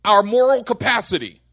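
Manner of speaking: loud, rising pulpit delivery; full force preaching. Acted. Pastor driving home God's love, loud and rising. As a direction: preach it to the back row.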